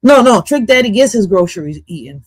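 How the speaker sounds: ordinary delivery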